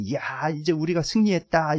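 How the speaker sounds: lightly voiced triumphant devil; gloating Satan. Satan celebrating after the crucifixion, lightly acted gloating. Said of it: "그래서 사탄이".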